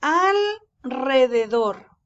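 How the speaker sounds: "Alrededor" slowly, "al" drawn out, then a trilled R; strong rolled R. Shown as a demonstration. Teacher showing the strong sound after L, slow rolled R. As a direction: roll the R hard in "Alrededor", split after "al". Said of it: "se lee con sonido fuerte".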